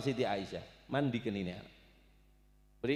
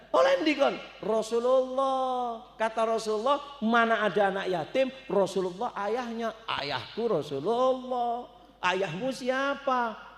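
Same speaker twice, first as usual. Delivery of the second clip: put-on child's voice; 8 semitones higher than usual. Children's dialogue.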